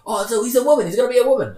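high, loud mock shout; excited crowd imitation. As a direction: mimic an eager audience calling out, way up high. Acting out workshop audience's eager request.

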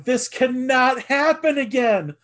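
high, slow and pleading, loud; desperate plea. Retold or imitated. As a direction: beg the game not to repeat itself. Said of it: "in the basement going".

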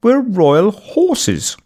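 high, very slow posh drawl; posh princely accent. A narrator's reading. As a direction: stretch it out like a pompous little prince. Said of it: "in a posh princely accent".

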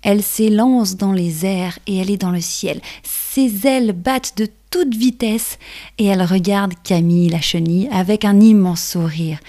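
plain reading voice

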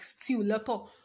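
ordinary talk

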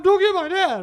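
put-on high, loud wail of an upset kid; mock crying child. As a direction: imitate a bawling child protesting. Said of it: "He's crying".

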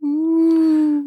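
loud wailing ghost moan; haunt them with a ghostly ooh. Acted. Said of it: "my best ghost impression".

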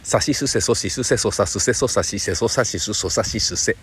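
deliberate sa-row drill; articulation drill. Shown as a demonstration. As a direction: run the syllables one by one. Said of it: "ダメだな。".

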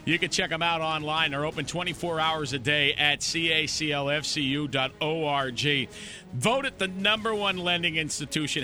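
upbeat announcer delivery, lifted pitch on the big lines; radio sponsor read. Real, not acted. Host closing the show and thanking the sponsor, announcer style.

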